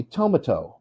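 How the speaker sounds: "tomato" as TOE-muh-toe; misstressed "tomato", TOE-muh-toe; stress on first syllable. Shown as a demonstration. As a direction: hit TOE in "tomato", middle reduced. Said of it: "If the first syllable is stressed".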